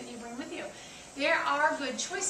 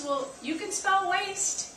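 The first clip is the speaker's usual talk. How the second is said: slow and higher, laughing through it; laughing punchline; 8 semitones higher, 5 dB louder, much slower than usual. Retold or imitated. Retelling a friend's quip about wasting fries, laughing slowly. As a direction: deliver the joke slow, laughing.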